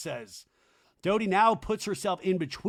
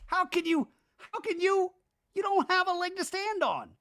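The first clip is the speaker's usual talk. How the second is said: much higher incredulous voice; Danny impression. Danny scoffing back at Jax, high incredulous voice. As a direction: squeak out disbelief, pitched way up. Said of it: "Danny goes".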